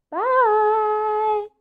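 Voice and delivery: duckling waving goodbye; tiny duckling voice. Acted. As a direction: chirp it like a small child.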